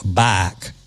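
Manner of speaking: "bike" said the Southern drawl way, like "bahk". Shown as a demonstration. Host showing his Louisiana hometown's southern drawl on the word. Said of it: "a southern drawl".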